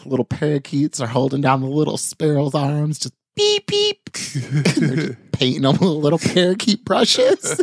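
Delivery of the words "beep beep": tiny bird beeps, very high; high squeaky beeps. Pretend. Parakeet story, a sparrow squeaks a very high beep. Imitating little sparrow sounds.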